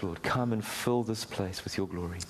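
ordinary delivery

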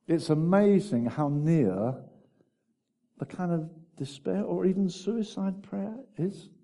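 usual speaking voice

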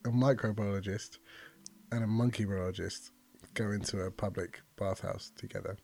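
ordinary delivery

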